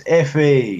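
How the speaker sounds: "iffy" drawn out slowly in two syllables; shown pronunciation. Shown as a demonstration. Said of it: "that place that you pronounce iffy".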